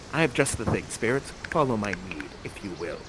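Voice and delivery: low, soft, sinking aside; conspiratorial instruction. Acted. Prospero asks the spirits to follow his lead, conspiratorial. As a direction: quietly draw helpers into the plan.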